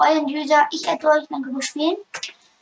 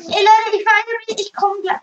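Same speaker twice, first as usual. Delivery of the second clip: excited; 6 semitones higher, 5 dB louder than usual. Real, not acted. Excited loud exclamation.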